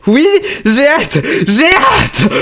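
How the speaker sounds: hammed-up squeal of impatience; can't wait, over the top; overexcited. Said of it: "Je me fais peur, là. Je suis un poil excessif".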